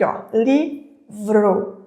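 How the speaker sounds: "livro" drawn out very slowly, the v and r held together; slow example word. Shown as a demonstration. Teacher to students, sounding out a consonant cluster, slowly. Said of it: "o encontro do V e do R".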